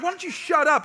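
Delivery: high-pitched exasperated retort; mock exasperation. Dad answering kids who bring it up, mock exasperation. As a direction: snap back in comic frustration.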